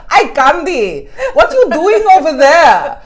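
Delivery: loud, high, hammed-up Brazilian accent; exaggerated Brazilian accent. Imitating her Brazilian colleague greeting her, exaggerated Brazilian accent. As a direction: call out in a mock Brazilian accent. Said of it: "like, the most racist accent".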